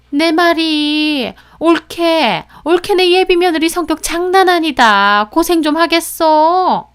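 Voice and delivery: high, drawn-out jeering relative; meddling relative. Acted. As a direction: chime in as a disapproving relative. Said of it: "하면서 거드는데".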